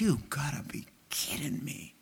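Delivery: whispered, stunned incredulity; imitated disbelief. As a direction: whisper it as if you can't believe it. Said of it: "He says".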